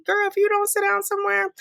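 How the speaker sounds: high, sassy "sit down" put-down; a high, sassy girlfriend scold. Acted. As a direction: tell her off with sass.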